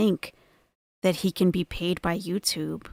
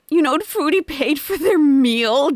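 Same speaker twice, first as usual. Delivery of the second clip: hammed-up high mock husband, louder and slow; a mock impression of the husband making excuses; 8 semitones higher, 7 dB louder than usual. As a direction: sneer it in his excuse voice. Mocking the cheating husband.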